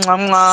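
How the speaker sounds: blowing two air kisses; between kisses. Real, not acted. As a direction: smack out kisses to the chat. Signing off with blown kisses.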